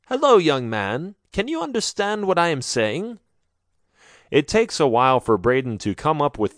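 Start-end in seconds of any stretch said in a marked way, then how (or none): 0.1-3.2 higher, gentle motherly voice; a mother checking on a stranger; kind older woman. A narrator's reading. Mother gently asks the stranger if he understands. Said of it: "an older woman says".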